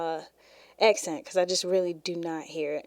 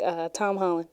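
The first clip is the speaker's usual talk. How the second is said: ordinary talk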